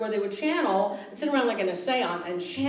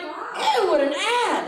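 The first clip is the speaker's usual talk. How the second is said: high, loud laughing ew; mock disgusted squeal; 9 semitones higher, 5 dB louder, slower than usual. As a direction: recoil at the ad. Disgusted reaction.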